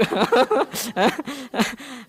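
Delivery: a bright burst of real laughter; hearty laugh. Real, not acted. Teacher laughing at the stingy audience, hearty laugh.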